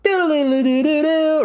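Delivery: high sung game jingle; mock video game fanfare on doo-doo; imitated quest-complete jingle. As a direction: trill a little high notification tune. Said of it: "it played the little quest complete".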